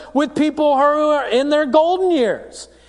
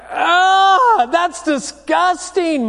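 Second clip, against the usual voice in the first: higher, louder, whiny groan of disgust; a teenager recoiling from the brownie mix; disgusted teen; 7 semitones higher, 4 dB louder, much slower than usual. Retold or imitated. Teen sees poop in brownies.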